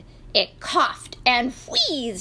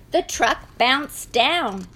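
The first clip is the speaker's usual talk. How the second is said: ordinary delivery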